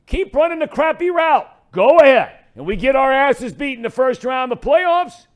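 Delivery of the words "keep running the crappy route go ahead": high, loud sarcastic dressing-down; telling him off. Retold or imitated. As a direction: quarterback chewing out his tight end. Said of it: "Just tell him".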